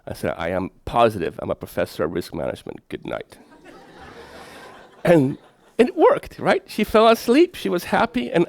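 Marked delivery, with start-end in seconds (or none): 0.4-3.4 firm bedtime reassurance. Retold or imitated. Father ends the talk with his daughter, low and soft. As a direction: lower and softer, closing it down.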